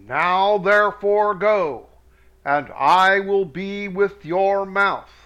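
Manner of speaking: slow, weighty reading of God's words; solemn scripture reading. Acted. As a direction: read it like a preacher, slowly. Reads God's words aloud solemnly.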